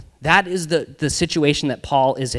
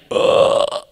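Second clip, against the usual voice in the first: ugh. Retold or imitated. Classmates reacting in disgust.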